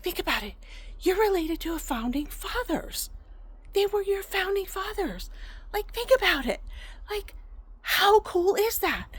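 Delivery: high, amazed, insistent dad; retold excitement rising with each repetition; imitating excited dad. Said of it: "he reiterates himself the more excited he gets".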